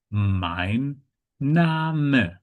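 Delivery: "mein Name" with a held final n in mein; clear word endings. Shown as a demonstration. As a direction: sound out "mein Name" as mine NAH-muh, every ending audible. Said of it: "pronounce the consonants at the end".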